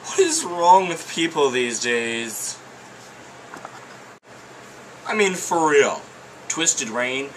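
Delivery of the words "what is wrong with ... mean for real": exasperated. Pretend. Exaggerated rant voice.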